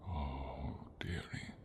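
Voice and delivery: very soft, under the breath; soft, hushed murmur of dawning realization. Acted. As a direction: let it out quietly, taken aback. Dragon reacts to a revelation.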